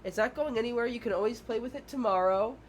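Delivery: put-on calm a small child down, offering another choice; reassuring teacher. Teacher to a child throwing a tantrum, high and reassuring. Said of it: "you're like".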